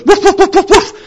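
loud, high-pitched volley of imitated barks; dog barking. Shown as a demonstration. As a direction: bark excitedly like a dog. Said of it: "The dog goes".

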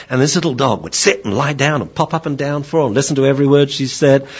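usual speaking voice

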